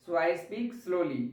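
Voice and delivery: very slow, drawn-out; slowly. Shown as a demonstration. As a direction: stretch the words to show slowly. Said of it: "धीरे धीरे बोल रहे हैं तो आपका स्लोली होगा". (धीरे धीरे खिंचा हुआ)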